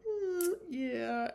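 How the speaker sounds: small, high, reluctant admission; sheepish. Retold or imitated. Two brothers owning up to dad, sheepish.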